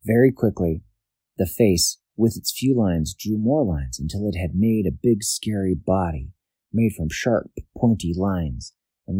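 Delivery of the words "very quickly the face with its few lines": usual speaking voice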